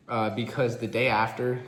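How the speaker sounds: ordinary delivery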